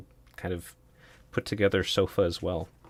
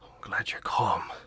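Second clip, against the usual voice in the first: whispering. Acted. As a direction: whisper it to keep them calm. Disguised figure whispering.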